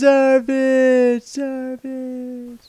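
high drawn-out shout that dies away each time; fading echo. Acted. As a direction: let the name echo off the peaks.

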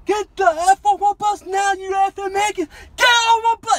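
high, furious bellow at raw recruits; drill sergeant screaming. Retold or imitated. Drill sergeant screaming recruits off the bus at night, furious roar. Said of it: "they were screaming at us".

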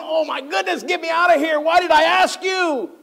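high, loud mock exasperation; exasperated. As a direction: beg to escape, dragging the last line. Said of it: "you're going".

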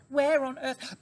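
high, amazed question; mother fox can't believe her eyes; astonished cry. A narrator's reading. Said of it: "she cried".

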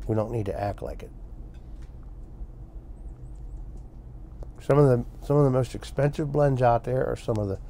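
talking around pipe stem; pipe in mouth. Real, not acted. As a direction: teeth clenched on pipe. Seen 0: Pipe stem clenched in teeth throughout; smoke visible; absent in refs.

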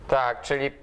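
ordinary talk, nothing marked

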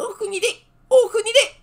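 high cooing parrot voice with a laugh. Acted. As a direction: coo it like a giggling parrot. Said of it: "en roucoulant".

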